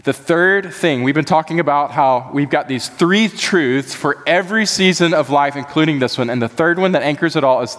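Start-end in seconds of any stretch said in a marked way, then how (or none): none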